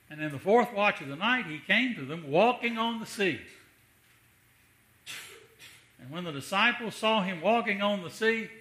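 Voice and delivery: loud, high, ringing sermon climax; full preaching voice building to a peak; full-force preaching. Acted. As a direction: preach it out with rising fire.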